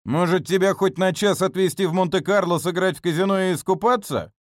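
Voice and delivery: loud, high sinister mockery; slow theatrical villain menace. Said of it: "зловеще рассмеялся Трескучий".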